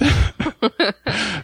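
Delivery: high sheepish laugh. Real, not acted. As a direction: laugh at your own mistake. Laughs at own story.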